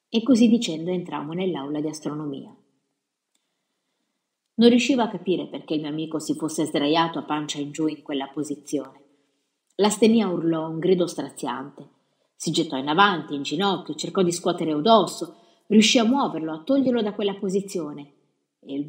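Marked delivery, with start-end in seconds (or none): none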